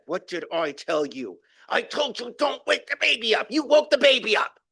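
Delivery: a mock furious mother telling them off; angry scolding mother.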